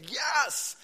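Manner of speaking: loud, high shout of yes; ringing high exclamation; excited yes. Real, not acted. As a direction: fire up the room.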